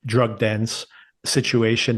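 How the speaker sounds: ordinary talk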